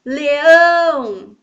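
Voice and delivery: "Leão" called very loud, long ÃO; loud, drawn-out final syllable in "Leão"; calling out. Shown as a demonstration. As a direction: call "Leão" at full voice, holding "ão". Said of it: "Vamos chamar cada uma".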